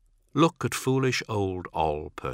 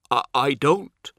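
slow, higher-pitched, halting words; faltering start; 7 semitones higher, 4 dB louder than usual. A narrator's reading. Ol, shaken after the demon vanishes, faltering. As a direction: stumble into a sentence and break off. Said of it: "he starts to say".

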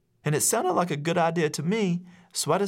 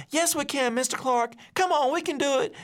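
put-on high-pitched kids begging their teacher; a classroom of kids urging him on, much higher; pleading kids; 13 semitones higher than usual. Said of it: "But the kids were like".

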